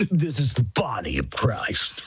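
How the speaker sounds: spooky, drawn-down intoning as a priest; horror-movie priest. Retold or imitated. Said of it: "like a three D horror movie".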